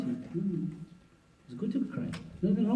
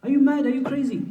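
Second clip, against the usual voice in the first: much higher, amazed rebuke; incredulous scolding; 9 semitones higher than usual. Real, not acted. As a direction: tell him off in disbelief. Mock scolding of husbands.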